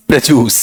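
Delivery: "प्रोड्यूस" as pruh-JOOS, rhyming with juice; falling "प्रोड्यूस" with a j in place of dy; purified pronunciation. Shown as a demonstration. Teacher after joking about juice, purified pronunciation. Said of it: "दूसरे का जूस नहीं".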